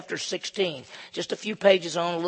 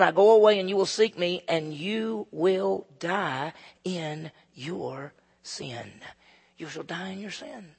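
drawn-out solemn warning; slower than usual. Acted. Pastor voicing Jesus' warning, slowing and fading away. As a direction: stretch it out, then drop.

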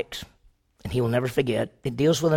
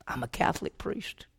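whisper, softer, the answer as a confession; 2 semitones lower, 11 dB quieter than usual. Acted. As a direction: breathe it out, barely voiced. Priest's quiet answer to officer.

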